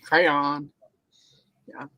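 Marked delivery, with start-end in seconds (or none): 0.0-0.7 "Crayon" in the speaker's Southern way; Southern pronunciation. Shown as a demonstration. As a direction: let the Southern accent show on "Crayon". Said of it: "It's a Southern thing".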